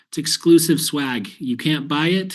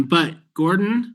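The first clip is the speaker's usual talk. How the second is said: ordinary delivery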